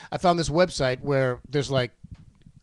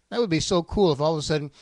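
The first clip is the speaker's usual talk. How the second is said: ordinary talk, nothing marked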